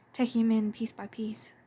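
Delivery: ordinary delivery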